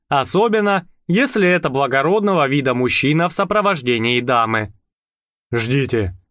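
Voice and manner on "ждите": curt. A narrator's reading. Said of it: "коротко бросил Отто".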